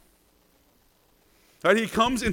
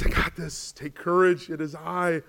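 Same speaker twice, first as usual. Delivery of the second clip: gentle, fading to a hush on afraid; reassuring Jesus; 3 semitones lower, slower than usual. Acted. Jesus comforting the sinking disciples.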